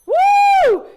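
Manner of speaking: high, loud whoop; whooping cheer. Real, not acted. Host whooping for her team, loud cheer. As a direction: let out a big victory whoop.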